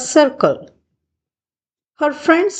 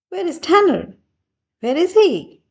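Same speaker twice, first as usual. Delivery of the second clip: high, puzzled calling out; high searching voice; 8 semitones higher, faster than usual. A narrator's reading. Sally and Seth searching for hidden Tanner, high wondering voice. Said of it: "asked Sally and Seth".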